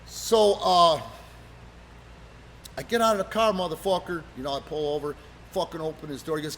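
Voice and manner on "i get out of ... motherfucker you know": normal talking voice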